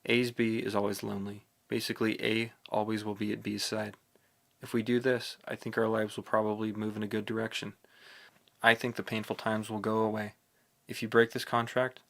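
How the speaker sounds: plain reading voice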